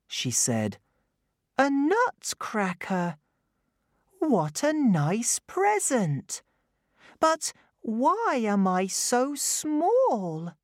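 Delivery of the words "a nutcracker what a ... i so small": put-on high, bright child voice; little girl voice. A narrator's reading. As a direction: speak as young Clara. Clara speaks.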